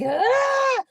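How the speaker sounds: high, loud comic scream; long hammed-up shriek; internal scream. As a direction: scream as if falling apart inside. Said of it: "I was like".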